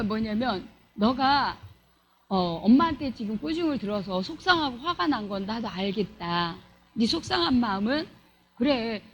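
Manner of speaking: soft, low, patient reassurance; calm the upset child down gently; understanding parent. Acted. Parent acknowledges the scolded child's hurt, softly and low.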